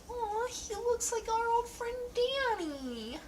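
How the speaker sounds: high, slow cooing voice; mock sweet coo; sweet friend voice. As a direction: coo it like a soft-hearted friend. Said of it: "she also sometimes is like".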